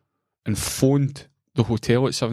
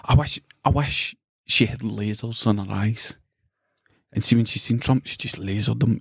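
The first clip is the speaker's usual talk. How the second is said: ordinary talk, nothing marked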